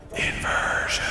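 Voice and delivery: whispered game title, hushed and unvoiced. Reviewer naming the game's cool title, whispering. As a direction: whisper it like a dramatic title reveal. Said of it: "Why am I whispering?".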